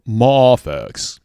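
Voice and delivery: Mafex with a long, drawn-out "MAH" first syllable; "MAH-fex" pronunciation. Shown as a demonstration. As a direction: say Mafex loudly as "MAAH-fex". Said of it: "I say Mafex".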